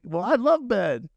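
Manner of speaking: stage pitch. Retold or imitated. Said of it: "I'm like".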